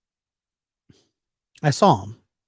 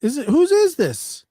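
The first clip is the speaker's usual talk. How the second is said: nosy coworker; 13 semitones higher, 4 dB louder than usual. Retold or imitated. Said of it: "And he says".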